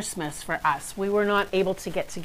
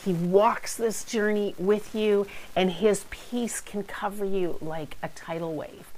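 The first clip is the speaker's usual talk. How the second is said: ordinary delivery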